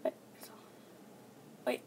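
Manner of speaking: usual speaking voice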